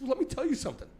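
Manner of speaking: milk every word like a tragedy; dramatic woman. Retold or imitated. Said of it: "And she said".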